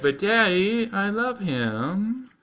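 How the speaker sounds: a hint of a whiny princess; begging daughter. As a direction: draw it out like a lovesick girl. Daughter begs father.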